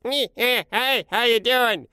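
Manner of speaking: very high slow imitation of the same boss; [name]'s boss imitation. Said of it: "[name]'s imitation was".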